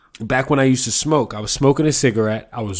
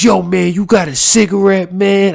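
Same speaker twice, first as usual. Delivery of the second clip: homeless guy's voice; 5 semitones higher, 6 dB louder than usual. Retold or imitated. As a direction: ask for a cigarette like a pushy guy on the corner. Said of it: "this is how he talked".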